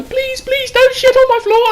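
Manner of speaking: pleading mum voice. Retold or imitated. Mum begging her son through the door, high pleading. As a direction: beg like a worried old mum. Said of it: "the poor old dear just fucking trying to go".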